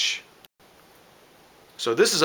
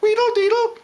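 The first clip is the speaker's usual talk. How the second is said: much higher, quick bird warble; blue jay warble; 5 dB louder than usual. Retold or imitated. Teacher showing the blue jay's other call, much higher quick warble. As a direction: trill it fast like a jay. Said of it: "It's kinda like".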